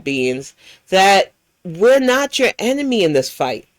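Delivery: ordinary delivery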